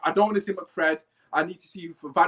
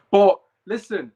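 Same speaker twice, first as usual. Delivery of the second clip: loud call to listen; 10 dB louder, slower than usual. Real, not acted. Demanding attention.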